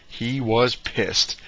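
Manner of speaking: He was pissed, slow and heavy; slow, deliberate repeat for emphasis. Real, not acted. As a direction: lean hard on each word. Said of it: "repeats for emphasis".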